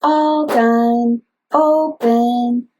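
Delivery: slow, sing-song, each syllable separated; slow syllable tapping. Shown as a demonstration. Therapist modeling words for a toddler, slow syllable-by-syllable. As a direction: spell out each syllable in a gentle child-directed voice. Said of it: "including clapping, like".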